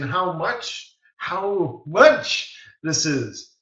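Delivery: slow, drawn-out "how MUCH this is"; emphatic. Retold or imitated. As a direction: stretch out MUCH to drive it home. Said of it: "I'm just emphasizing".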